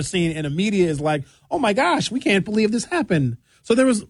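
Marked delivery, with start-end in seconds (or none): 1.5-3.4 high-pitched fake disbelief; mock shock. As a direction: imitate scandalized media, voice pitched way up. Mocking the media's shocked reaction.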